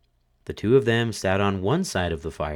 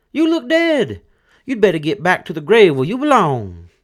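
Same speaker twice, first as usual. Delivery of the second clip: much higher, louder scolding widow; widow's voice; 11 semitones higher, 9 dB louder than usual. A narrator's reading. Widow scolding her dead husband, high and loud. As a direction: sneer at the corpse.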